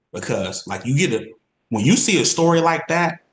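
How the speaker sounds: ordinary talk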